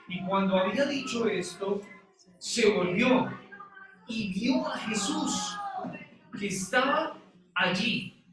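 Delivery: preacher declaiming scripture with drawn-out phrases; scripture reading. Acted. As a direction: read the verse out deliberately, stretching the key phrases. Reads the verse aloud to congregation.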